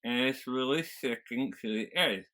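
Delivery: talking round a fingertip in the mouth; garbled American-accented speech over a finger. Shown as a demonstration. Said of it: "if I put the tip of my finger".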